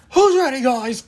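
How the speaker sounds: high, loud rally call to the crowd; hype man rally. Pretend. Leading a pretend rally of followers, loud hype call. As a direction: fire up the crowd like a cult leader. Acting as cult leader at rally.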